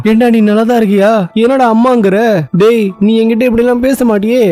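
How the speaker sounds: high-pitched, disbelieving heroine; baffled questioning. Acted. Heroine doubts the hero is all right, baffled.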